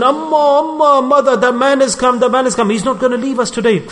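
frightened daughter. Retold or imitated. Frightened daughter warns her mother.

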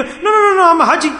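high-pitched, imitated indignant denial; mock protest. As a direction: mimic someone brushing off all criticism. Acting out a person refusing correction.

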